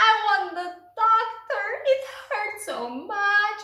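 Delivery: high, loud pleading in labor pain; a pained patient begging for the doctor; mock pained plea. A woman in labor begging the nurse, high and pained.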